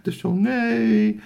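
high, drawn-out toy voice; little polite robot. Acted. Squeaky Toy bowing to the trolls, high and courteous.

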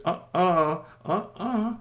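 high nervous denial. Acted. Bernie denies poking.

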